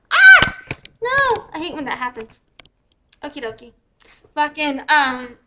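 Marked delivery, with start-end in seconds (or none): 0.1-1.5 sudden high, loud yelp of dismay; startled cry. Real, not acted. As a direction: yelp as the video breaks. Video fails to play.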